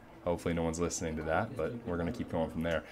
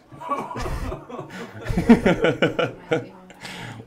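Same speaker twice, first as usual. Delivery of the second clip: hearty laugh; 10 semitones higher, 7 dB louder than usual. Real, not acted. Big laugh at own joke.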